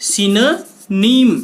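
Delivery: सिनोनिम slowly, "si-nuh... neem"; teacher drawing out सिनोनिम without an "o"; syllable-by-syllable pronunciation. Shown as a demonstration. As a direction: stretch सिनोनिम in parts, middle "nuh". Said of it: "क्या पढ़ते हैं?".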